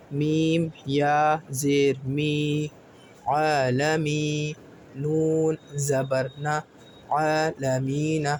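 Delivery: very slow drawn-out letters; slow spelling. Shown as a demonstration. Teacher spelling العالمین slowly for learners. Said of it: "عین کو صحیح سے ادا کریے گا".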